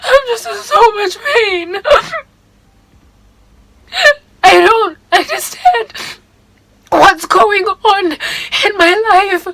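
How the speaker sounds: high, slow, tearful voice breaking; crying. Real, not acted. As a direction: talk through real tears, slowly. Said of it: "Even though I wanna hold the tears back".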